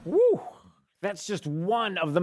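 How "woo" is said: loud woo cheer. Real, not acted. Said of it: "cheer after clip".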